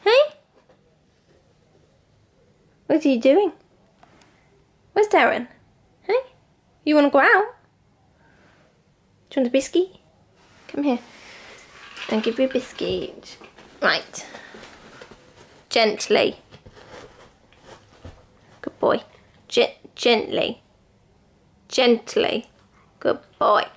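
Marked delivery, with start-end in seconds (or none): 0.0-11.0 high, loud call to the dogs; excited dog call. Real, not acted. Calling to the dogs, high and loud. 15.7-23.6 loud, slow Gently to the dog; firm gentle command. Real, not acted. Feeding the dog a biscuit, firm and loud.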